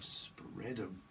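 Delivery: soft, drawn-out delivery; low-key suggestive take. Acted. Someone to a lover instead of an arrest, softly suggestive. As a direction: say it quietly, stretching the words.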